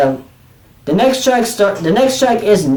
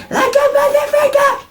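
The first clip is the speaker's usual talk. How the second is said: mock shriek, very high and strained; imitating a scream. Reviewer mimics the guitarist's screamed line, very high mock shriek. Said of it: "you hear [name] saying".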